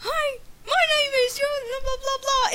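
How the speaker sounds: high squeaky imitation of a dubber; mock bad dub voice. Mocking the British lady dubbing Fruits Basket, high squeaky. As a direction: squeak it out like a terrible voice actress. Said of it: "She was dubbing all the characters".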